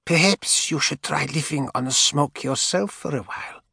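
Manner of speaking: high, mocking old woman voice. A narrator's reading. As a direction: needle her like a spiteful old lady. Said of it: "Helena said".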